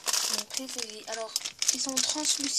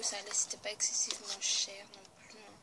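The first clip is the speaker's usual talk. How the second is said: whispered side comment; whispered aside; 3 semitones lower than usual. Real, not acted. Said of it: "je chuchote un peu".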